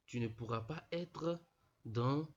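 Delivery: ordinary talk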